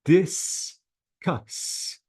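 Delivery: "Discuss" slowly broken into dis, cuss; two syllables. Shown as a demonstration. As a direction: leave a gap inside "Discuss" after dis. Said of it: "two syllables".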